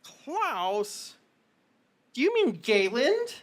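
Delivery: high, confused grandma; wondering old woman; puzzled old lady. Acted. Old woman asking about her son, high and puzzled. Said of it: "She goes".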